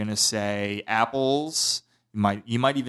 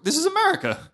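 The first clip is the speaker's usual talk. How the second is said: mock patriotic proclamation.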